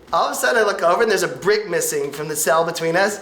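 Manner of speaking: usual speaking voice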